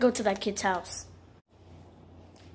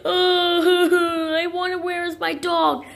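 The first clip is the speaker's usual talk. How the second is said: high, drawn-out pretend wail; wailing, hammed-up sobs; mock crying; 4 semitones higher, slower than usual. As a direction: cry theatrically over a lost pet. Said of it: "Lenny's gonna be crying all day".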